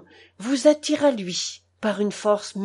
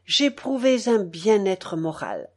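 ordinary delivery, nothing marked

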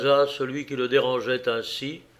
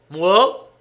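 short, loud, raised grunt of a question; grunted surprise; 6 semitones higher, 9 dB louder than usual. A narrator's reading. Surly diner interrupted by a stranger, grunting. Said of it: "grogna son interlocuteur".

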